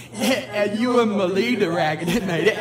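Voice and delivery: real laughter breaking through tearful words; laughing and crying. Real, not acted. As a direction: caught between a laugh and a sob. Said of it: "I'm laughing and crying".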